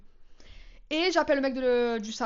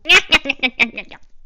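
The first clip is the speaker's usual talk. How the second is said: loud laugh; 11 dB louder than usual. Real, not acted. Laughing at her story.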